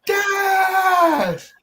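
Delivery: high, drawn-out mock scream; long shrill-pitched yell, reenacted. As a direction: scream the name as if trying to wake someone. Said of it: "we are screaming our lungs out".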